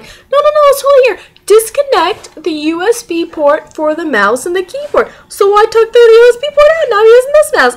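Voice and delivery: high, loud, insistent argument; indignant self-defence. Retold or imitated. Student proving her point from the lab instructions, indignant.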